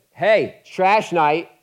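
loud, high call to take the trash out; wife reminding her husband from the kitchen, loud and high. Retold or imitated. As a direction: call it across the house, loud. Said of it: "the wife, she says".

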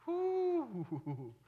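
high, drawn-out "whoo" sliding down; high whoop. Acted. Preacher swooning over an old country song, high whoop. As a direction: let out a long high whoop.

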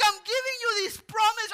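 high and loud, indignant; mock-stern Gabriel; angel's rebuke. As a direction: scold like an offended angel.

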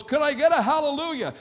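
high, loud preacher's call to the crowd; worship leader call. Retold or imitated. Pastor imitating a worship leader calling on the church, high and loud. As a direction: belt it out like rallying a congregation. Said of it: "So when somebody goes".

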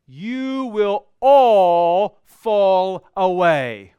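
slow, loud and weighty, each word set down; solemn pronouncement. Acted. Jesus warning his disciples, slow and loud. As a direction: deliver it like a verdict.

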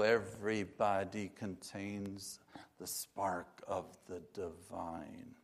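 slow, falling, fading to very soft; slow down and let it sink away softly; hushed reverence. Acted. Preacher gives Unity's answer to exclusion, hushed and reverent.

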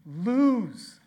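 high, loud burst; loud single word; sudden loud word. Real, not acted. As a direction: punch it out. Loud after whisper.

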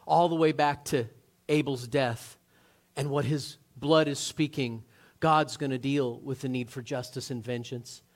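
ordinary delivery

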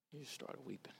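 hushed, grieving repetition. Real, not acted. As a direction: let it fade to almost nothing. Repeats line, fading each time.

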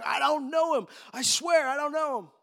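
loud, high-pitched, heated oath as Peter; swearing denial. Acted. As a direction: swear it forcefully at your accusers. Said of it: "Peter starts swearing and cursing".

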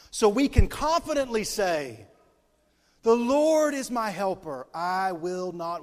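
scripture preached slow and loud; preaching scripture. Acted. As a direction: proclaim it with rising fervour.

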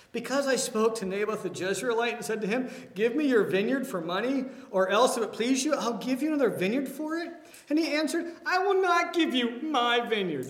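a touch of a petulant whining king. Acted. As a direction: whine it like a sulking king. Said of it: "I think that's the tone".

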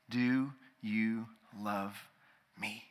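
low, soft, very slow; tender, hushed question. Acted. Jesus gently asks Peter, low, soft and slow.

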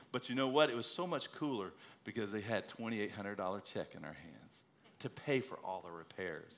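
ordinary delivery

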